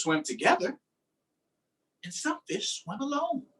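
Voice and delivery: lively, sing-song picture-book reading; reading to children. Acted. Teacher reading a fish book to her class, lively storytime voice. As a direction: read it out like storytime for little kids.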